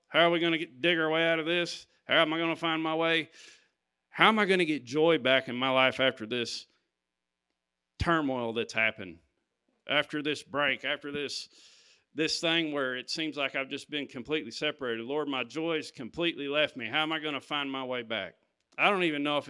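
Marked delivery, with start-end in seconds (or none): none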